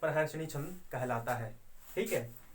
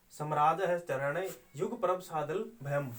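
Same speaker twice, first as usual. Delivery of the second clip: slow chanted verse with pauses at the yati; metrical recitation. Shown as a demonstration. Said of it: "वाचन होता है". (यति के साथ धीमा श्लोक पाठ)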